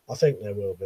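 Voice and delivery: quiet murmured echo of his own words; muttered repeat. Shown as a demonstration. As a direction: say it again under your breath. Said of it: "repeats his own answer".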